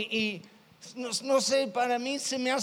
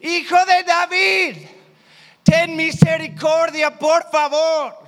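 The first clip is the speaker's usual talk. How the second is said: higher, much louder pleading shout; desperate shout; 6 semitones higher, 10 dB louder than usual. Acted. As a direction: cry out with all your voice so he hears you. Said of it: "gritó aún más fuerte".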